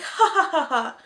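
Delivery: hammed-up scoffing laugh, slow at the end; mock dismissive laugh. Vlogger mocking season one as nothing, scoffing. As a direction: laugh it off like it's trivial. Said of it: "be like".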